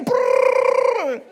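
loud, high machine-gun trill; rapid-fire rolled burst, very loud; imitated gunfire. Preacher firing answers like bullets, loud gun noise.

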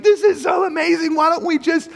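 very high, hammed-up giddy gushing; gushing excitement. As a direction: gush like a thrilled friend on holiday. Acted road-trip enthusiasm.

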